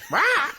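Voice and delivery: very high parrot screech; parrot squawk. Acted. Parrot to a passing guy, squawking. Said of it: "looks at him and goes".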